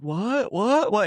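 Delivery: high, rising bewildered whats; baffled students blurting out questions; confused what. Acted. Students pushed out the door, high and bewildered. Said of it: "They're both like".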